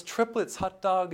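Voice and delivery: very high, delighted whoop; overjoyed. Retold or imitated. New father told of triplets, very high joyful whoop. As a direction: leap up cheering the news.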